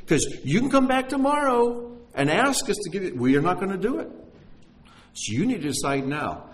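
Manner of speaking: pushy salesman. Acted. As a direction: push hard for a decision, pitch climbing high. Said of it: "gotten a sales pitch".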